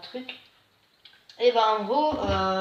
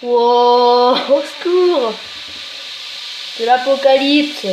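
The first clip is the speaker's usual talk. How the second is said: excited shout; 3 semitones higher, 12 dB louder, much slower than usual. Real, not acted. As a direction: yell out in mock panic. Reaction to the eruption. (cri excité devant l'expérience)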